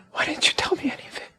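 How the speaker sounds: soft, breaking away into a whisper; hurt whisper. Acted. Man asking why Ada hid her love, hurt whisper. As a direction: whisper it as a wounded question. Hurt plea to absent woman.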